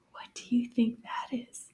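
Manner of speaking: soft, secretive whisper. Pretend. Teacher asks children to guess from a clue, whispering. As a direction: whisper the question to draw children in.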